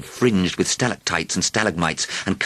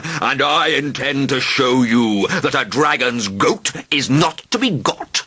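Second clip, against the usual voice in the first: higher, louder and slower dragon growing testy; threaten, getting crosser each line; increasingly testy; 10 semitones higher, 5 dB louder, slower than usual. A narrator's reading. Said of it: "becoming increasingly testy".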